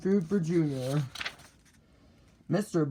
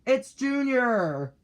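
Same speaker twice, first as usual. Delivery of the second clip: high, drawn-out joyful cry; excited shout; 8 semitones higher, slower than usual. A narrator's reading. Father bird greeting his hatched baby, excited shout. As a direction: announce it slowly in high excitement. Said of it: "he shouted".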